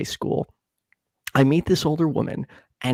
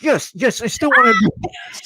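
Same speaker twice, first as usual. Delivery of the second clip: eager; 7 semitones higher than usual. Retold or imitated. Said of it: "I'm like".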